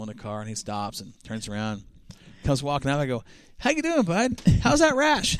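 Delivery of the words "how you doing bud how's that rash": high, cheeky mock-friendly tease; teasing greeting. Teasing a young driver in the pits, cheeky high greeting. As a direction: needle him with a grin, pitched well up. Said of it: "Corey's famous line".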